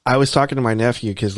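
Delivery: ordinary delivery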